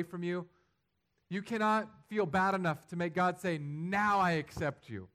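climbing pulpit fervor; impassioned preaching. Acted. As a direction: build the sermon to a fervent peak. Preaching at full force.

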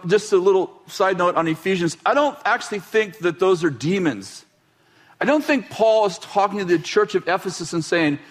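usual speaking voice

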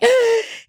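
sharp high frightened gasp; panicked gasp. Retold or imitated. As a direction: gasp in sudden dread. Panic cold returning.

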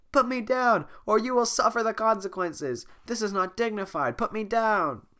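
high, loud furious threats; furious princess. Acted. Fiona carried off.

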